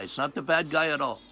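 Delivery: normal talking voice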